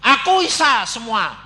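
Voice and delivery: loud, high, drawn-out boast; boastful mock quote. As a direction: brag it out big and slow. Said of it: "tidak pernah berkata gini loh".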